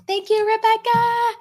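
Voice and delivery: high, loud, drawn-out thank-you; gushing singsong shout; excited thanks. Real, not acted. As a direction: sing out thanks across the room. Thanking a viewer by name.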